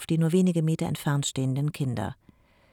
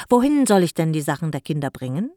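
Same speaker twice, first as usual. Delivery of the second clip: eager; 8 dB louder than usual. Acted. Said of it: "fragte Jako eifrig".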